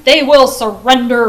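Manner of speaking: booming shout; bellowing. A narrator's reading. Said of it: "bellowed the emperor".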